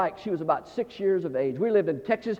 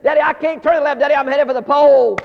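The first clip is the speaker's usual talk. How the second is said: high, very loud wail to Dad; child hollering; 7 semitones higher, 10 dB louder than usual. Retold or imitated. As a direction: imitate a panicking kid yelling for help. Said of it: "She started hollering".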